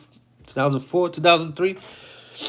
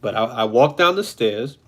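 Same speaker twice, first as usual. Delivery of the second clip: ordinary delivery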